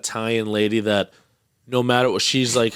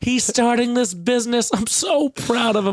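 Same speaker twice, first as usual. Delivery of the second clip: high-pitched gushing mother impression; imitated proud mom; 11 semitones higher than usual. Friend mimicking his mom bragging about his business, high mock gushing. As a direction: brag about your child in a put-on mom voice.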